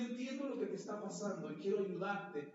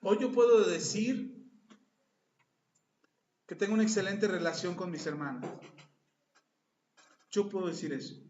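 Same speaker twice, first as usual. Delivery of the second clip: much louder, fervent preacher closing; preaching at full force; 7 dB louder than usual. Acted. Pastor closing the sermon to the congregation, fervent preaching. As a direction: proclaim it to the whole congregation.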